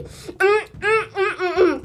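high, happy mms of delight; savoring delicious food. Real, not acted. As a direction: hum with pleasure while chewing. Tasting good candy.

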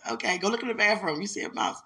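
loud, high call to the bathroom; calling out to son. Retold or imitated. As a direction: send the kid off, shouting. Mother calling son again.

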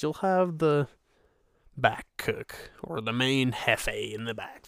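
ordinary talk, nothing marked

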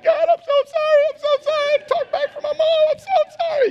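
hammed-up scared sinner wailing sorry; frantic apology. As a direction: beg forgiveness like a terrified kid at the altar. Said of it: "You're like".

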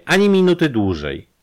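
loud, contemptuous final threat; hateful, menacing ultimatum; threatening. Acted. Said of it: "lekceważenie i groźba".

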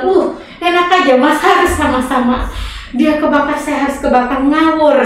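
mock indignation. Survivor retorting to people teasing about not getting burned, mock-indignant. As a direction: bat the tease away with playful outrage.

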